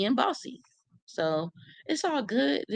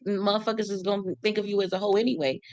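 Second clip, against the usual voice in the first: ordinary talk, nothing marked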